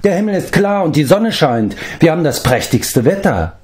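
surprised call. A narrator's reading. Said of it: "rief Flevdur".

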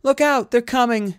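urgent, high, loud yell; shouted warning. Acted. Cornered fighter warns rescuers of beasts, shouting loudly. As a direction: scream a warning across the field. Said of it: "she cried as loudly as she could".

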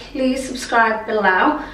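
ordinary talk, nothing marked

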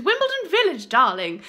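a loud, camp "darling" tacked on; mock-posh Wimbledon lady. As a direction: toss off "darling" like a grand lady. Posh playful address.

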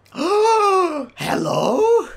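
high, drawn-out cooing hello; delighted gasp. Real, not acted. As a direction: gasp and coo at the puppy. Gasps at dog.

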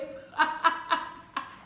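hearty burst of laughter; real laugh. Real, not acted. Speaker amused at own praise of Igbo accent, laughing. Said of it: "forget that I'm laughing".

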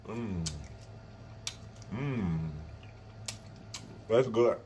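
muffled chewing hum; chewing while talking. Real, not acted. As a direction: mouth partly full. Seen 1: Beef put in mouth at -3; jaw chewing, lips pursed during words.

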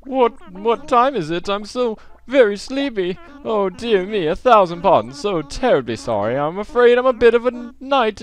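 put-on high, fussy old-gentleman voice; high dithering owl voice; sleepy owl professor. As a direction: wake up flustered and overly polite. Voices owl villager Blathers.

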